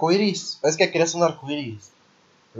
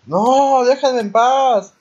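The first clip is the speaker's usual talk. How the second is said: loud, high, slow desperate cry; panicked shout; 8 semitones higher, 9 dB louder, much slower than usual. Real, not acted. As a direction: scream at them to back off. Chased, begging to be left.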